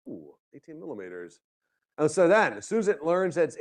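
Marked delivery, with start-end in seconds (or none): 0.1-1.4 very soft, low echo; hushed mock awe. Smart control hearing the rain forecast, hushed and low. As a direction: take in the big number under your breath.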